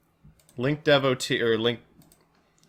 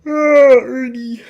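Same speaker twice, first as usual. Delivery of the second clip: high puppet frog voice; Kermit voice; 12 semitones higher, 10 dB louder, much slower than usual. Acted. Streamer answering chat as Kermit, high frog voice. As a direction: slip into Kermit for a beat. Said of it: "my Kermit impression".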